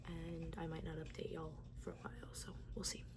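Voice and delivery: hushed aside whisper. Real, not acted. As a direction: whisper it like a secret.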